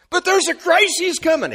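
high, loud worried objection; acted alarmed protest. Believer objecting to promised peace, in acted alarm. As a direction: blurt it out like a rattled doubter.

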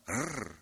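a long trilled rrr; rolled R. Shown as a demonstration. Preacher demonstrating a rolled R. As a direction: roll the R out. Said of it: "Can you roll the R?".

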